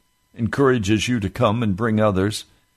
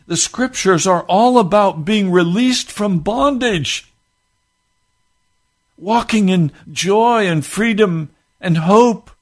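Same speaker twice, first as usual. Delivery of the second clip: higher, louder, slow pulpit cry; fervent preaching; 10 semitones higher, 5 dB louder, slower than usual. Acted. Preacher promising release from bondage, slow fervor. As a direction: lift them toward freedom.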